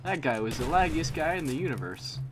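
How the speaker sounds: high, loud disbelieving rant; excited outburst. Real, not acted. Gamer ranting about a laggy enemy, loud and excited. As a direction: blurt it out in amazed frustration.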